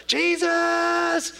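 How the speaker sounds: high, loud calling out of names; crying out. Retold or imitated. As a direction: call out to him over the crowd. Beggar crying out to Jesus.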